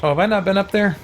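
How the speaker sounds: ordinary delivery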